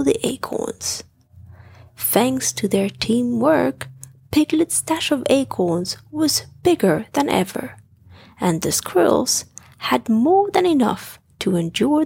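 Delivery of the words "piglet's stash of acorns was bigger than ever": ordinary delivery, nothing marked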